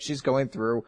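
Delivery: ordinary delivery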